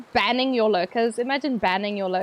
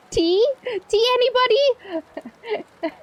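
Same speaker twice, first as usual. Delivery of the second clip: high, drawn-out mock British tea offer; 11 semitones higher, much slower than usual. Streamer teasing Brits about their tea, mock British.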